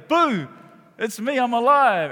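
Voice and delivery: high, loud mock scare; spring it on them, loud and high; playful surprise reveal. Sister surprises friend who thought her dead, gleeful loud reveal.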